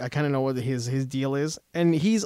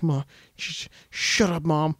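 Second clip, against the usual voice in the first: hesitant, softer mumbled objection; timid protest; 2 semitones lower, 5 dB quieter, slower than usual. Retold or imitated. Timid kid muttering back at his mom, hesitant and quiet. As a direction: stammer it out softly, barely daring to say it. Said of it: "he's usually pretty timid".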